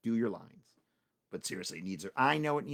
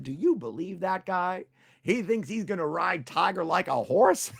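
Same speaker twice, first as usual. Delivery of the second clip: laughing disbelief; 7 semitones higher, 8 dB louder than usual. Acted.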